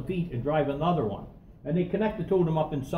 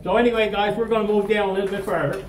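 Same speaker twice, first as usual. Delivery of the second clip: loud, higher-pitched guide's announcement; real raised-voice call to move on; calling to the group; 6 semitones higher, 6 dB louder than usual. Real, not acted. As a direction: project to the whole party to get them moving.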